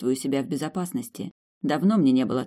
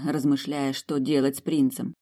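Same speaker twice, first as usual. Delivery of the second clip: ordinary delivery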